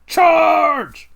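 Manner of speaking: shouted charge, high and loud; battle cry. Acted. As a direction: yell the charge.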